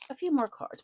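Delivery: usual speaking voice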